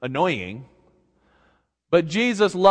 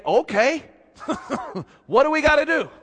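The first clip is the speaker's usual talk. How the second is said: the king's quick surrender; 10 semitones higher, 4 dB louder, faster than usual. Acted. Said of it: "the king of Nineveh didn't say".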